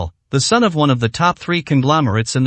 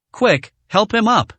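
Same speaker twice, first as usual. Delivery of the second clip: higher-pitched, urgent order; a quick call for help, pitched up; hasty order; 6 semitones higher than usual. A narrator's reading. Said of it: "the captain said hastily".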